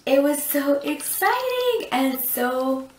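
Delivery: high, stretched-out excited gush; gushing excitement. Real, not acted. Vlogger recalls telling family about the baby, gushing excitement. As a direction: draw it out, thrilled.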